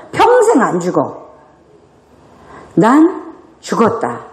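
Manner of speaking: usual speaking voice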